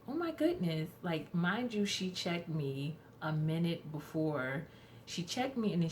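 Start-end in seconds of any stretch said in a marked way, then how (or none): 0.1-0.9 high mock gasp of surprise; doctor's high surprised oh; imitating a startled doctor.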